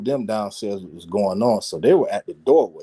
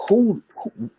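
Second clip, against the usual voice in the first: incredulous; 10 semitones higher than usual. Retold or imitated. Retold disbelief at being extorted.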